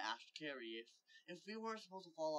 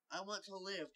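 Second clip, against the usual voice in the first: half-hearted scream, pitched up; mild mock scream; 6 semitones higher than usual. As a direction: scream it without the volume. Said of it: "Tack Adams screamed".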